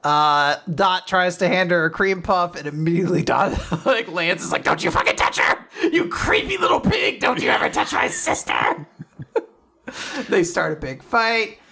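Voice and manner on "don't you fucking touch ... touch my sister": angry protective outburst. Acted. Lance yells at Dot.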